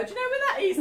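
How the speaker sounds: high, loud question through laughter; laughing mock question. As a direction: act out asking the way while laughing. Laughingly acts asking for directions.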